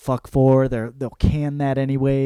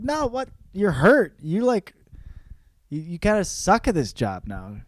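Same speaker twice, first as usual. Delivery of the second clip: higher, incredulous boss; mocking boss; 5 semitones higher than usual. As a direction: scoff at him in a higher voice. Boss scoffing, voiced.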